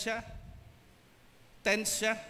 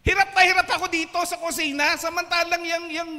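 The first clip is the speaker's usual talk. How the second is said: loud complaint; 7 semitones higher, 7 dB louder than usual. Acted. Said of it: "Sabi niya".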